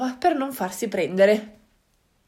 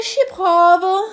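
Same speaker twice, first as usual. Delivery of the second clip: slow, louder, put-on zebra reply; a hesitant zebra agreeing to try; 6 semitones higher, 7 dB louder, slower than usual. Zebra agrees to help the animals, in a slow put-on voice.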